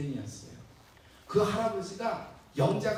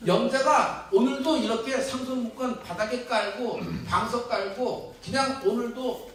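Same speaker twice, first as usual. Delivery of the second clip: louder rousing sermon voice; preaching; 4 semitones higher, 8 dB louder than usual. Real, not acted. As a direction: challenge the congregation from the pulpit. Pastor challenges congregation. (힘차게 외치는 설교 톤)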